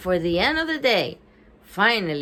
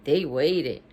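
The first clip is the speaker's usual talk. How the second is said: plain reading voice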